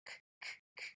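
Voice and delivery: k, k, k, as a whispered bare k sound; sounding out words. Shown as a demonstration. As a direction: repeat the k sound, breathy and unvoiced. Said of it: "think of that first letter".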